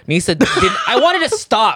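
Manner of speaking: high, loud, laughing; laughing protest. Real, not acted. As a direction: protest through real laughter. Laughing while telling embarrassing story.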